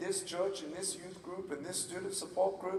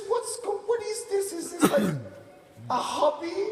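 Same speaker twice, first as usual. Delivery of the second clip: very high, flustered mock questioning; exasperated mom; 11 semitones higher, 4 dB louder than usual. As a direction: imitate a mother at a loss. Mother interrogating her son.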